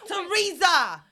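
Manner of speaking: high, loud laughing scream; screaming the name. Acted. Hailing the TV medium, screaming. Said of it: "what are you both screaming".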